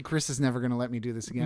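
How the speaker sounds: ordinary talk, nothing marked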